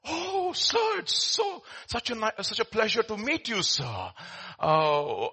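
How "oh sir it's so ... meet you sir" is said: very high, starstruck fawning; gushing ticket collector. Acted. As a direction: gush like you've met your hero. Collector gushing at meeting Einstein.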